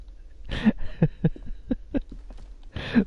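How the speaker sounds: breathless high giggle; high wheezy laugh. Real, not acted. Streamer keeps laughing.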